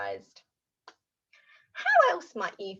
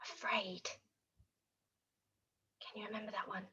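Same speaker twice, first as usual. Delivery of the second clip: scared whisper; 3 semitones lower, 16 dB quieter, faster than usual. Shown as a demonstration.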